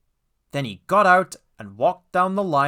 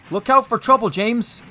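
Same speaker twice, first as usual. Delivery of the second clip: high, loud, urgent caution; warning; 8 semitones higher, 6 dB louder than usual. A narrator's reading. Driver warning James before the hill, urgently. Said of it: "warned his driver".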